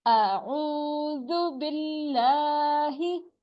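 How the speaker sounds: slow, stretched recitation; measured tajweed chant; Quranic recitation. Shown as a demonstration. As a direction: recite slowly with long vowels. Recites the phrase.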